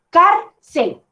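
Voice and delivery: "cárcel" in two separate beats, CAR, cel; split into syllables. Shown as a demonstration. Teacher shows the syllable division, "cárcel" said as CAR, pause, cel. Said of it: "he hecho la división silábica".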